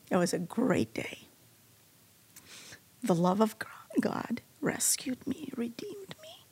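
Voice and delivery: tearful, breaking into a whisper; barely able to get the words out; real tears, whispering through them; emotional whisper. Real, not acted. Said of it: "I don't know why I'm getting emotional now".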